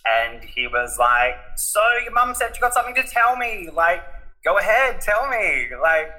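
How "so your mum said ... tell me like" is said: high-pitched, excited prodding; eager dad. Retold or imitated. Dad phoning his son for the big news, eager. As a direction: play an excited father pressing for news.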